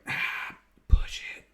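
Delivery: hushed spice dealer whisper; breathy whisper. Pretend. Spice dealer offering salt and pepper, whispered. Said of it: "he was like".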